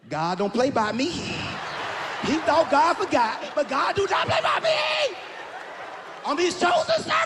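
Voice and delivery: high, slow shouted sermon voice; gloating churchy ex. Retold or imitated. Scorned ex gloating over his burning car, high preaching shout. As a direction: preach it like she is God's chosen.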